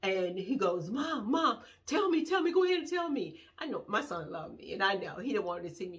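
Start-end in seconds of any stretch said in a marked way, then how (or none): 0.9-3.4 high-pitched urgent begging as her son; imitated pleading son. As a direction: mimic a worried son pleading. Son pleads with crying mother.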